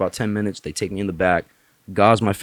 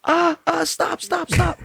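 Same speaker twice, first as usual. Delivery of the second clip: much higher, squealing stop stop; pained nurse; slower than usual. Retold or imitated. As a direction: yelp it as your hand gets crushed. Nurse hand squeezed hard.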